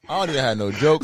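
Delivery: ordinary talk, nothing marked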